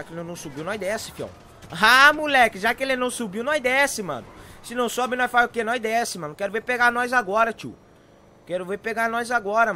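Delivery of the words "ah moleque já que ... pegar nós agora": loud, cocky triumphant yelling; gleeful shouting. Real, not acted. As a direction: crow over the escape at full voice. Triumphant escape down a slope. (gritando eufórico)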